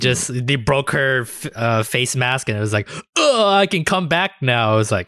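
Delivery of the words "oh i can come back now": mock cheerful. Mocking character's easy return.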